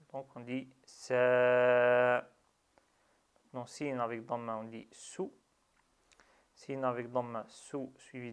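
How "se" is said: se held long and louder. Shown as a demonstration. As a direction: draw out the vowel of se. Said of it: "il sert à prolonger". (se prolongé)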